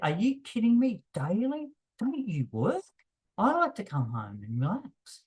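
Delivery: hammed-up scoffing disbelief at texting daily; incredulous. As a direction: imitate someone who cannot believe it. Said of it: "they're just serious, seriously saying it".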